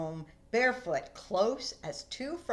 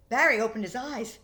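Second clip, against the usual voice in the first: plain reading voice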